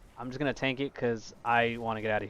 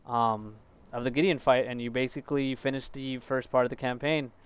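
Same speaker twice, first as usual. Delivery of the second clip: ordinary talk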